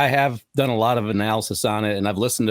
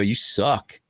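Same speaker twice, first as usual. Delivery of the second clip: blunt heckle. Retold or imitated. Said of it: "walk right up to me and go".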